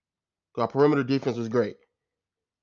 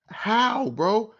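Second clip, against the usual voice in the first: incredulous; 7 semitones higher, much slower than usual. Real, not acted. Disbelief at the collapse.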